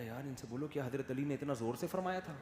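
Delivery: soft, hushed remark to a friend; quiet aside. Retold or imitated. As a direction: murmur it aside during the sermon. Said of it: "میں نے کہا".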